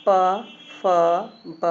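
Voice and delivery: put-on teacher to children, demonstrating lip sounds: crisp and separate; 1 lip consonants demo. Shown as a demonstration. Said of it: "इसके उच्चारण में बच्चों".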